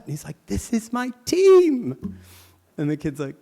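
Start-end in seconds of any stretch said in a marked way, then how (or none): 0.5-2.2 much higher, slow, loud; missionary showing the kid his team; excited claim. Retold or imitated. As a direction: insist with delight.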